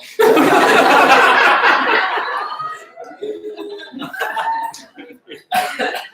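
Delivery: high, long helpless laughter. Real, not acted. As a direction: laugh until breathless. Laughing at dad's reply.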